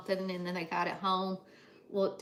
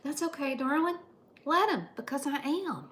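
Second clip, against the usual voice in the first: higher and louder, sugary granny sweetness; sing-song darling reassurance; sweet reassurance; 6 semitones higher, 5 dB louder than usual. Real, not acted. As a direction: coo it like a doting grandmother.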